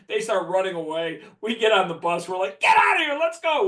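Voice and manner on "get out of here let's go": loud, very high urgent yell; yelling to leave. Retold or imitated. Players on the bus urging the driver to flee, loud high yell. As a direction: shout it up high to get the bus moving.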